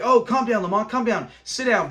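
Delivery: mock-urgent settle-down; overplayed calming. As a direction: imitate someone overdoing the calming act. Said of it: "I think, kinda overplays it".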